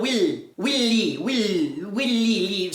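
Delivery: "will" stopped on L, alternated with full "Willy"; swallowed final I. Shown as a demonstration. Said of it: "você engole o som antes de fazer o I". (will engolindo o I, alternado com Willy)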